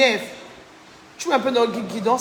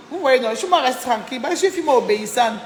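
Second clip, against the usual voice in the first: ordinary talk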